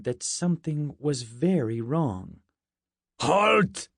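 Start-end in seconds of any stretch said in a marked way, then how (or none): 3.2-3.9 put-on loud, high Russian-accented challenge. A narrator's reading. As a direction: call out sharply with a Russian accent. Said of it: "He had a thick Russian accent".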